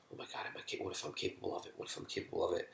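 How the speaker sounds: anxious, hushed self-questioning; acted-out worried whisper, barely voiced; whispered worry. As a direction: whisper it to yourself as a nagging doubt. Acting out anxious looping thoughts.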